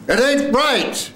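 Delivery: very high, slow cry; full-out acted line. Actor ending the first scene, high drawn-out cry. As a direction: throw it up high and hold it.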